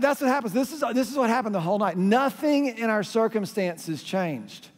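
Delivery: normal talking voice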